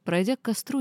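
usual speaking voice

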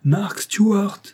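high, loud call across the mountain; resounding call. Acted. The old man calls Mark Stewart across the mountain, high loud call. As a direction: shout the name so it echoes. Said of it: "d'une voix qui résonna au loin dans la montagne".

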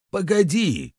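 high-pitched cry to wait; urgent exclamation. A narrator's reading. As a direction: cut in urgently, pitch leaping up. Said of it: "воскликнул травник". (тревожный высокий возглас)

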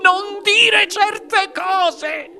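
high, slow, plaintive wail; tearful protest. A narrator's reading. Mother begs husband to stop, tearful wail. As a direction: protest through tears in a high voice. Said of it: "disse la signora tra le lacrime".

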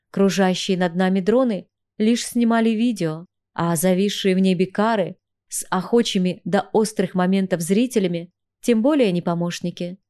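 usual speaking voice

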